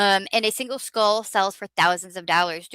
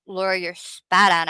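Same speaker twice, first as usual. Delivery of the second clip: ordinary delivery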